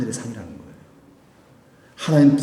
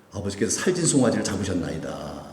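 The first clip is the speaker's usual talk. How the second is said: lower, sullen reported grievance; resentful elder son; 6 semitones lower, 6 dB quieter than usual. Acted. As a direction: let the complaint sink bitterly. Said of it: "아주 멸시하면서". (못마땅해하는 큰아들 말투)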